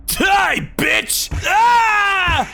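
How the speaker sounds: very high, raging yells; screaming as they attack; furious shouts. Acted. Thugs fighting back.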